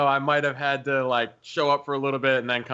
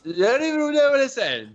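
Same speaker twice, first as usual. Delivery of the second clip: put-on higher, louder slurred party girl voice; drunk girl impression; 11 semitones higher, 4 dB louder than usual. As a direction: play a tipsy girl whining at her friend. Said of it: "Doing my drunk girl impression".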